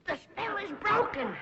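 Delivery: high, slow wail of disappointment; theatrical lament. Acted. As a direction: wail that the magic is over. Lovestruck woman laments broken charm.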